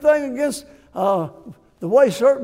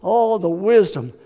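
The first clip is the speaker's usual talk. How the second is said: ordinary talk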